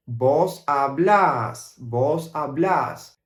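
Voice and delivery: slow "Vos hablás", the final "-blás" stressed and long; Argentine vos form. Shown as a demonstration. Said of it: "with the pronoun vos".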